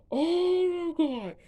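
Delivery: high, slow, loud exclamation; stunned by the test result; shocked oh my God. Retold or imitated. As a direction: drag it out in disbelief. Reacting to the lie result.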